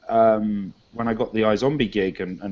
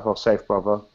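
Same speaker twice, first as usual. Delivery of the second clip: low, casual street-accent thanks; London street slang; 3 semitones lower, 4 dB quieter than usual. Shown as a demonstration. Thanking someone for tea, low London street accent. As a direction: accept it coolly in London street talk. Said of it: "if you gave me a cup of tea".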